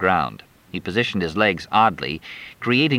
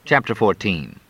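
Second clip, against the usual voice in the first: usual speaking voice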